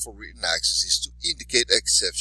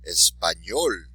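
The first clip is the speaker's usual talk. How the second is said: Español with the final "ñol" stressed; stress on last syllable; 3 semitones lower, slower than usual. Shown as a demonstration. Teacher modelling words for students, last syllable of Español stressed. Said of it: "accent on the last syllable".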